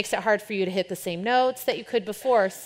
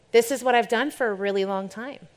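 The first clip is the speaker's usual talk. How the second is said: usual speaking voice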